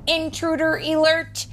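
high, loud robotic warning, stress on alert; alarm computer voice. A narrator's reading. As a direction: blare it like a security alarm. Alarm voice in story.

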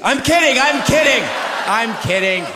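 loud and high, hurried; shouted backpedal. Real, not acted. To the groaning crowd, loud and high protest.

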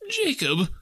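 high-pitched pleading; imploring. A narrator's reading. Scrooge begging his dead partner for comfort, imploring. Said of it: "he said imploringly".

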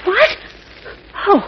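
frightened sharp question; startled what. Acted. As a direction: jump at the word. Lois startled by Jimmy.

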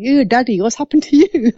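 high-pitched, grossed-out little daughter; imitating daughter. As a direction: voice a daughter squealing at her dad. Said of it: "my daughter's like going".

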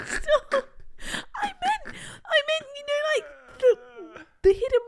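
high, squeaky words through helpless laughter; crying with laughter. Real, not acted. Embarrassed girlfriend explaining the flaps to boyfriend, crying with laughter. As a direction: try to explain while laughing to tears.

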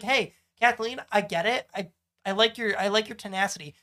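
ordinary talk

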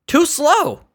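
high exasperated too slow; exasperated repeat. Acted. Comic fed up with slow phone menu, high whine. Mocking repeat of own complaint.

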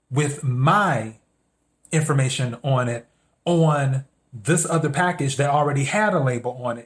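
ordinary talk

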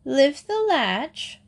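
high, very slow call; grandmother calling out. A narrator's reading. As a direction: call out from bed, stretching each word. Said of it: "called out the grandmother".

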